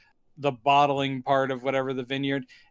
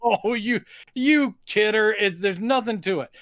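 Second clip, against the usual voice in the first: mock jovial teasing; 6 semitones higher than usual. Acting out friend's jovial teasing reply.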